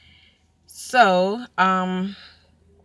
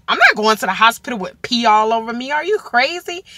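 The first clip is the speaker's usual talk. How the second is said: louder, high and fast; refusing the hospital, indignant and louder; 5 semitones higher, 6 dB louder, faster than usual. Retold or imitated. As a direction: protest loudly. Retold refusal to husband.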